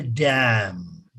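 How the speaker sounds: DAM said as "dyam"; local pronunciation of DAM. Shown as a demonstration. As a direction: slip a y-glide into DAM. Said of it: "Many people say dam".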